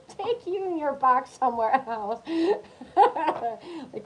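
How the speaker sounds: laughing through a loud high dismissal. Real, not acted. Laughing as she tells the old lady to leave. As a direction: chuckle while shooing her off.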